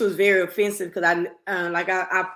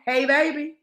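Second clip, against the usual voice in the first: mock flirty quote; 7 semitones higher than usual.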